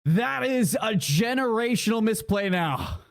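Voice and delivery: shoutcast climbing to a high-pitched peak; play-by-play building excitement. Real, not acted. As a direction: drive the call up to the climax.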